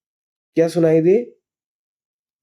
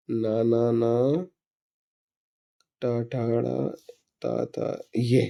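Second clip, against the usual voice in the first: softer mumbled sounding out of letters; muttering to himself; 4 semitones lower, 9 dB quieter, much slower than usual. Real, not acted. Teacher searching the chart for a letter, muttering softly. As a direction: mumble the letters while you search the page.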